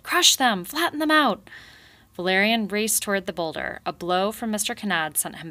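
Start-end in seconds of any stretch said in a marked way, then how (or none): 0.0-1.5 high, loud order to attack; cry out. A narrator's reading. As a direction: bark it at the troops, high. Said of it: "Mister Kanad cried".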